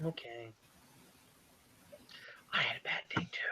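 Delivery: near-whisper, very soft and low; hushed little complaint; small hurt voice. Acted. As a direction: say it tiny and wounded, almost to yourself. Character sulks after being rebuked.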